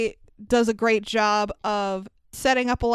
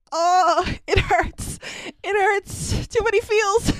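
mock anguish; 9 semitones higher than usual. Mock anguish over Dobby.

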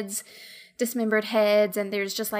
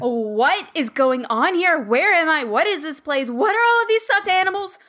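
rising panic, getting louder; frantic questions, louder each time; panicked; 6 semitones higher, 5 dB louder than usual. Acted. Ramona panicked questions in character.

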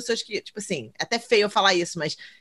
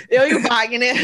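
laughing; 4 dB louder than usual. Retold or imitated. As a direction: say it through laughter. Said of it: "Agora eu tenho que falar".

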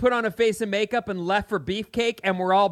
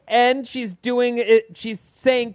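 ordinary talk

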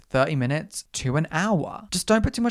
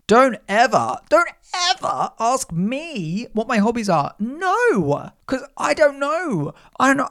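much higher, mock panicked shriek on don't; mock screaming; 9 semitones higher, 4 dB louder than usual. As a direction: squeal it in hammed-up horror at the hobbies question. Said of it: "I start screaming".

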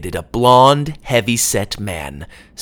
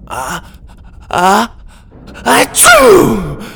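two rising gasps then a loud high sneeze; boy's sneeze that can't be held; building sneeze; 9 semitones higher, 7 dB louder, much slower than usual. A narrator's reading. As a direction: wind up and explode into the sneeze. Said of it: "The sneeze echoed".

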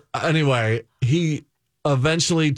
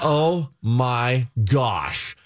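drawn-out, very slow dismay; 2 semitones lower, much slower than usual. Acted. Reliving the panic for the hosts, slow dismay. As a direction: let each word sink slowly in mock dread. Reenacts wave of panic in stomach.

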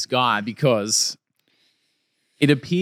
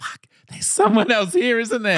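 mock alarmed realisation; 10 semitones higher than usual. Acts out colleague's horrified realisation.

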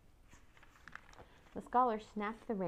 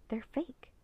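higher half-whisper; hushed; 4 semitones higher, 4 dB quieter than usual. A narrator's reading. Girl among silent tomb soldiers to her brother, hushed. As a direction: keep it secret, almost whispered.

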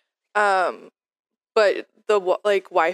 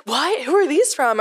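higher-pitched puzzled questioning; imitated bewilderment; 8 semitones higher than usual. Husband questions server about mystery shots, imitated bewilderment. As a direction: ask as the baffled recipient, pitch lifted.

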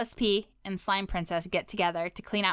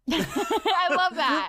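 laughing; 9 semitones higher, 3 dB louder, much slower than usual. Real, not acted. Laughing at a listener's name.

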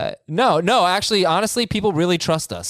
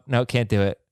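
ordinary talk

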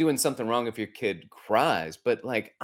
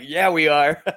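laughing out a loud yes; 7 semitones higher, 8 dB louder than usual. Real, not acted. To his son about grown-up fears, laughing loudly. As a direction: laugh through the admission, louder. Laughing agreement with son's remark.

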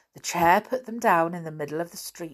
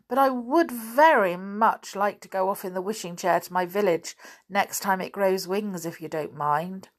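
plain reading voice

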